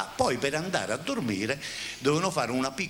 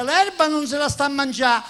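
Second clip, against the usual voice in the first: wife voice; 11 semitones higher, 7 dB louder than usual. Acted. Wife answers from window.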